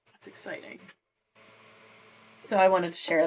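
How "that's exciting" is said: breathy excited whisper. Real, not acted. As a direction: whisper it to yourself. Whispered aside about progress.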